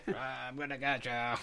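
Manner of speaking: slow, creepy monster voice; haunted house monster. Retold or imitated. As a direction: draw it out like a lurking monster. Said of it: "almost like a haunted house monster".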